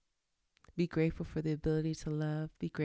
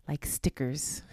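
normal talking voice

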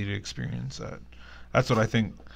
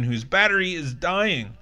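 normal talking voice

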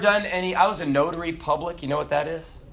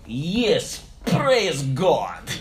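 put-on slow, emphatic Russian accent; 4 semitones lower, much slower than usual. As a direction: imitate a Russian believer's proud answer. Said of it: "he said in his Russian accent".

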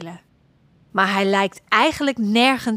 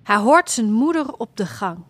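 ordinary delivery

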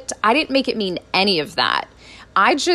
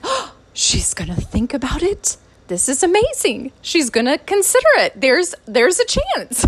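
excited; 5 semitones higher than usual. Retold or imitated. Hearing mom may say yes.

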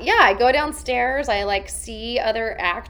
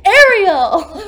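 loud high laughing call of his name; urgent call; 8 semitones higher, 14 dB louder than usual. Retold or imitated. Actor calling the compliance guy in a panic. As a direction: grab his arm and call him. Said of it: "and I'm like".

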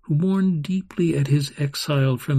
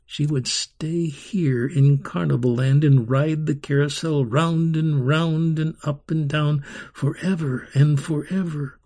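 plain reading voice

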